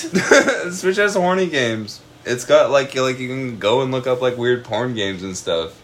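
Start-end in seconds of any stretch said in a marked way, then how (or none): 0.1-2.0 laughing repeat. Real, not acted. Repeating it to a stunned cohost, laughing slowly.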